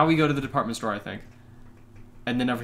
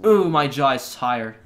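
ordinary talk, nothing marked

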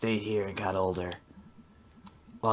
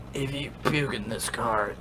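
usual speaking voice

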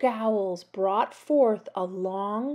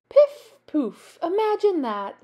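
high, loud delighted witch; gleeful cackling; 9 semitones higher, 6 dB louder than usual. A narrator's reading. Witch delighted by her taste, gleeful. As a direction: exclaim it high with glee. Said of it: "cackled gleefully".

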